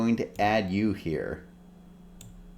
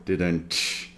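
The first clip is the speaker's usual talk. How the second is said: rimshot; 4 semitones lower, 5 dB quieter than usual. Acted. Rimshot after the joke.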